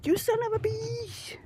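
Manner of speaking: high squeaky cartoon curse; squeaky silly voice. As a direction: replay it as a goofy squeal.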